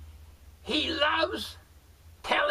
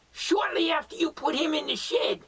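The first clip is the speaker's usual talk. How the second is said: usual speaking voice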